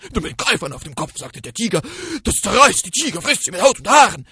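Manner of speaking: loud, fast terrified tiger; panicked tiger. Acted. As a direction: rush the words in fear. (hastiger, ängstlicher Tiger)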